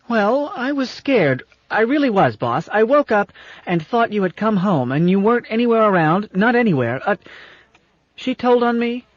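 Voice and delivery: put-on high, pleading child's voice explaining herself; a frightened child talking fast to her boss; scared little girl. A narrator's reading. Ship explaining to her captain as a frightened little girl.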